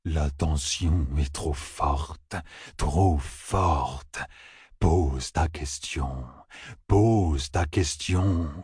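low, very slow, droning voice; solemn oracle man. A narrator's reading. Man cutting in to urge the question, slow solemn oracle. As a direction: intone it low and drawn out.

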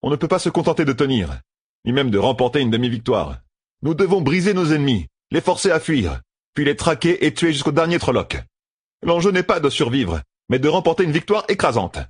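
voix plus haute, ton grave de stratège; voix de personnage. A narrator's reading. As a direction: exposer le plan avec gravité. Matt exhorte à la victoire. (voix de chef militaire résolu)

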